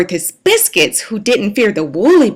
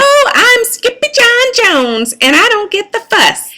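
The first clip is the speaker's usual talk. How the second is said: much higher, louder carefree kitten; a kitten brushing off his mama's fuss, high and carefree; kitten boy voice; 11 semitones higher, 3 dB louder than usual. A narrator's reading. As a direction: toss it off like a cheeky kitten. Kitten answers his mother.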